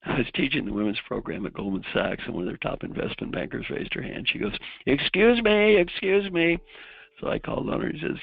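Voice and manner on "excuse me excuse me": high, loud imitation of an eager interrupter; calling for attention. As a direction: call out to get the teacher noticing you.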